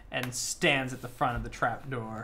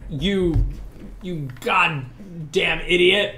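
louder, slow cursing in broken Nodish; broken Nodish swearing; 4 semitones higher, 6 dB louder, much slower than usual. Acted. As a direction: spit the insult out slowly. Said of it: "a couple of swear words".

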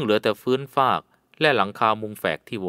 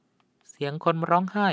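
put-on murmuring young woman; 7 semitones higher, much slower than usual. A narrator's reading. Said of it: "พึมพำว่า".

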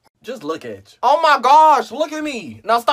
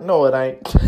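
normal talking voice

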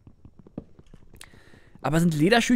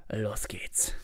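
whisper; 10 semitones lower, 11 dB quieter than usual. Real, not acted.

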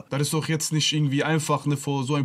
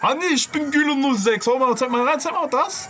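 high, mocking taunt; taunting boxer; 7 semitones higher than usual. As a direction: mimic a cocky fighter egging on. Voices Gülo taunting her opponent.

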